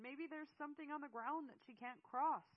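high, loud exasperated guess; frustrated yell. A narrator's reading. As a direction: keep yelling, at a loss. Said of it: "she yelled in frustration".

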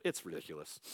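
ordinary delivery

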